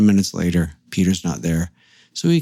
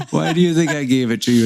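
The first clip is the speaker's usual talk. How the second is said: laughing; 6 semitones higher than usual. Retold or imitated. Laughing while quoting Heather.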